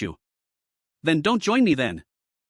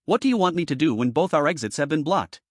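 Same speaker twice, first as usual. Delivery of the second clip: ordinary talk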